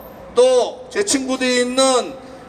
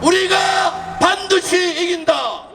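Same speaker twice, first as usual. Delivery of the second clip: roaring rallying speech; 5 semitones higher, slower than usual. Real, not acted. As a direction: bellow each line of the slogan. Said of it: "불렀던 구호를 ... 다시 하겠습니다".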